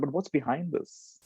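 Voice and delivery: ordinary talk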